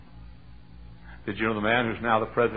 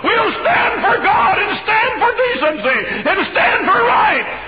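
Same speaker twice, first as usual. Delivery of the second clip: very high, very loud rallying cry; preaching shout; 11 dB louder than usual. Real, not acted. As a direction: call the church to stand with you. Final rallying call.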